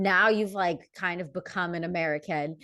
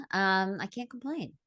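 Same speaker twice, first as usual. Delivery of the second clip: ordinary delivery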